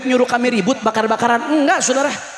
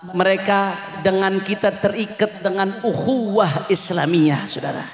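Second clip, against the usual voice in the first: somber appeal; 9 semitones lower than usual. Real, not acted. Preacher asks the congregation to pray for quake victims, hushed and grave. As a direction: lower the voice in mourning for the dead.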